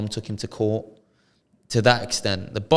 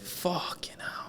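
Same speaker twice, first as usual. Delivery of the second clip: slow, higher whispered fucking hell; awed whisper; 9 semitones higher, 12 dB quieter, much slower than usual. Real, not acted. Friend amazed at seventy training hours, slow whisper. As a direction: breathe it out in disbelief.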